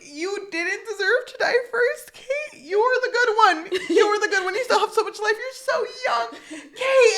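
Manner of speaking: high, loud hammed-up sobbing; mock tearful wailing. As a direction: wail in fake grief, laughter breaking through. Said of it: "he's in tears".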